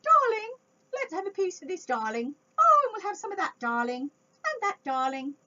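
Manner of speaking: high, loud hammed-up posh "darling". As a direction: play a snooty diner ordering grandly. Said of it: "calling each other darling".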